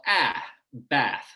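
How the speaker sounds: American "bath" with "a" as in cat; low front vowel. Shown as a demonstration. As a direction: show the flat-A vowel, then "bath". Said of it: "I use a low front vowel".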